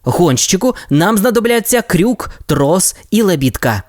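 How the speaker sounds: put-on brief the team like a young captain; lightly voiced Ryder. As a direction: Ryder gives the pups their mission. Ryder orders gear.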